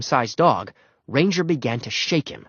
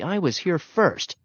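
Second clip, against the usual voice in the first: high, slow dog voice; arguing dog; 6 semitones higher, slower than usual. A narrator's reading. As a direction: insist slowly in a higher doggy voice. Growling dogs argue in turn.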